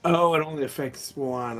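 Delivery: ordinary talk, nothing marked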